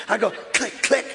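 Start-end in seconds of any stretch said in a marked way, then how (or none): 0.3-0.8 whispered gun-cocking click; voiced click of a gun. Preacher miming a gun cocking at intruders, whispered click. 0.8-1.1 high, loud threat; threatening shout. Retold or imitated. Preacher acting out threatening a home intruder, loud shout.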